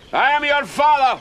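angry fatherly shout. Acted. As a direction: bellow it at your son. Father shouts at defiant son.

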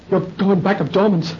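usual speaking voice

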